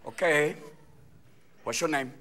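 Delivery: low, intimate coaxing; sweet referee. Acted. Referee flirting with a soccer player, sweet and low. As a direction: murmur it like a flirty official. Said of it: "the referee is so sweet".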